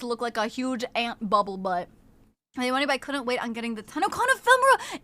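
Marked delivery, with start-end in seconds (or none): none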